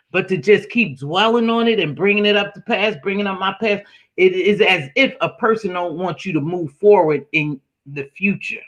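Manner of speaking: ordinary delivery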